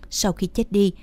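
ordinary delivery

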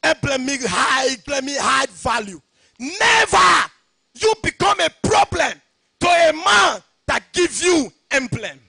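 loud, high, driving pulpit shout; fiery sermon cadence, ringing out; preaching at full force. Acted. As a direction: hammer each line home to the church. Preaching, defining emblem.

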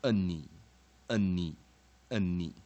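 "嗯你， 嗯你， any" as loud, separate repeats with falling pitch; weak final vowel. Shown as a demonstration. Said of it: "美式的话是弱译音哈".

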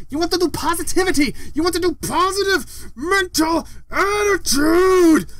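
slow, high, emphatic rant building to a shout; ranting yell. Real, not acted. Giving viewers the secret to life, ranting into a yell. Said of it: "this is just me yelling".

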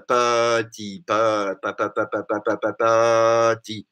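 low "pe-" repeated as "puh"; drawn-out schwa drill. Shown as a demonstration. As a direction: chant the schwa of "pe-", then release "petit". Drills the vowel of pe.

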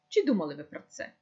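ordinary delivery

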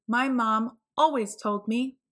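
ordinary delivery

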